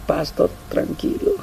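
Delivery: whispered, slow and soft; whispered plea. Retold or imitated. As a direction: back away in a hushed voice. Member backs off from the cross. (susurro temeroso)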